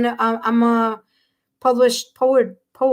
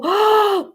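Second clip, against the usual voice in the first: loud, high gasp; quick burst of indrawn breath; amazed gasp; 10 semitones higher, 5 dB louder than usual. Real, not acted. Reader learns of the Aquarius tattoo, amazed gasp.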